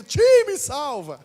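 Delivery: put-on boy planning to yell to his uncle for help; planned yell for help. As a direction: call out slowly to the uncle for rescue. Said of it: "eu só vou dar um grito".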